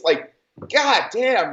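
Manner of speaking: ordinary delivery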